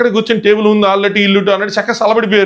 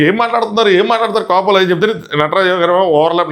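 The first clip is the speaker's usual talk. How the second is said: ordinary delivery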